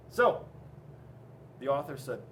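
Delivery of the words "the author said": usual speaking voice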